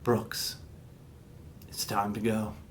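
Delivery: low, hushed, ominous summons; creepy father. Retold or imitated. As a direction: half-whisper it low, like a menacing alien dad. Father creepily walks toward son.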